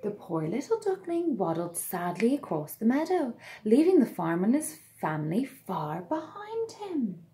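put-on lively read-aloud to children; storybook narration. A narrator's reading. Narrator telling children the tale, bright and lively. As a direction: read it like bedtime story time.

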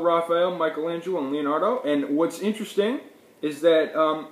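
ordinary talk

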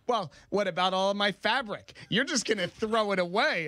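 high, indignant mom voice; imitating mom. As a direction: play the offended mother, pitched high. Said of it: "my mom said, like".

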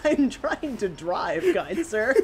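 mock polite. Mock-polite complaint, laughing.

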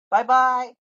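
a playful chirped bye-bye to the NPC; sing-song goodbye. Pretend. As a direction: sing it out cheerfully. Waving off NPC.

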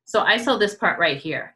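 usual speaking voice

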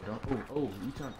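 usual speaking voice

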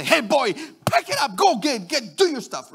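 brisk, mildly angry parental order; mad. Retold or imitated. Father telling his boy the second time, mad. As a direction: fire off orders like a fed-up dad. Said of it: "Now, now I'm mad".

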